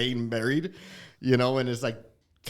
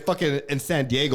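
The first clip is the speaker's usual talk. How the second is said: usual speaking voice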